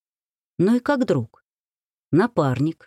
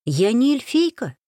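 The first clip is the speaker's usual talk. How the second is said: higher, mildly indignant protest; habitual indignation; 6 semitones higher than usual. A narrator's reading. Wife correcting husband's pet name, mildly indignant. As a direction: object with familiar mild indignation. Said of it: "возмутилась уже привычно".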